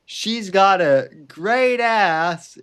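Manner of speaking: slow, high quoted line; drawn-out slow quote; quoted movie line. Retold or imitated. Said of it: "if he can do that line".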